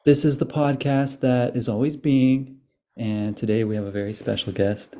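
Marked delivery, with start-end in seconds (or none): 0.1-2.6 announcer voice. Acted. Podcast intro tagline.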